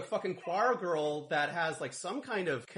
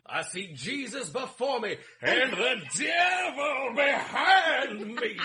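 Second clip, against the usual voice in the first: slow, booming preacher voice, much higher and louder; mock televangelist sermon; 9 semitones higher, 4 dB louder, slower than usual. Imitating Tim Curry preaching to his flock, slow mock shout. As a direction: ham up a hellfire sermon, stretching every word. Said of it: "those moments where he's doing his sermon".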